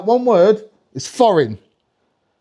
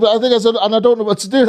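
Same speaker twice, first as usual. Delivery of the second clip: usual speaking voice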